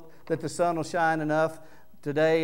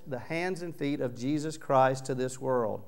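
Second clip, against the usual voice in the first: ordinary talk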